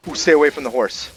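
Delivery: alarmed call. Real, not acted. Warning off attacker near horse.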